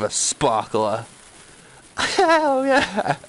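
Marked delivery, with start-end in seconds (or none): 2.0-3.2 high whooping cheer through a laugh; excited cheer. Real, not acted. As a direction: whoop it out, thrilled. Cheering after writing name.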